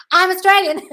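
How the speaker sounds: loud, high-pitched playful protest; mock indignant. Real, not acted. Coach told her accent is English, mock indignant. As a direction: exclaim it, about to burst out laughing.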